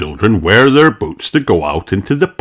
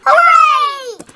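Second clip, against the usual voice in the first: very high cheering cry; squealing hooray; 4 dB louder than usual. Acted. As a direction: whoop with joy. Children cheer in story.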